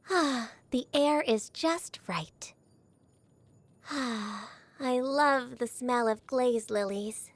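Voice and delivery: slow, breathy whisper of delight; hushed and slow, enjoying the breeze. Acted. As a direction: whisper it as if savouring the air. Savouring fresh air outdoors.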